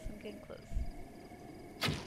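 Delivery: slow sneaky whisper; hushed creeping whisper. Pretend. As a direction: breathe it out slowly while sneaking up. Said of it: "Okay, I'm getting close".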